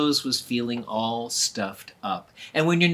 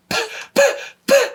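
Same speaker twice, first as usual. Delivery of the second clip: spluttering puffs; 7 dB louder than usual. Acted. Sputtering after being sneezed on.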